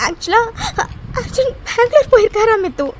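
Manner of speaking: tearful retelling; crying to her friend about the news. Acted. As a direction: say it through sobs.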